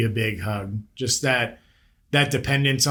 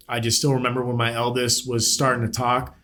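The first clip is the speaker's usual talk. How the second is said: ordinary talk, nothing marked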